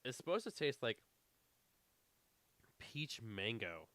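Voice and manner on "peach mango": ordinary talk, nothing marked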